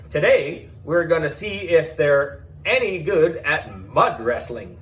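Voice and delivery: high, bright showbiz presenter voice; TV host. Acted. Reality show host welcoming viewers to the camera, showbiz voice.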